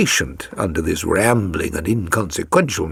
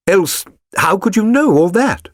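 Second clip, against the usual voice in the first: cried out; 10 semitones higher, 4 dB louder than usual. A narrator's reading. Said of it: "she cried".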